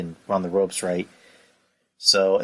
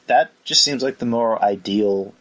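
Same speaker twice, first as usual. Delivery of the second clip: ordinary delivery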